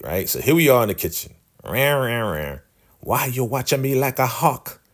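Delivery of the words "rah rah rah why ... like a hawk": high, put-on scolding voice of an old woman; mock elder woman. Elder woman snapping at Ruth in the kitchen, high put-on scold. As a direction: squawk it like a bossy elder catching a snoop.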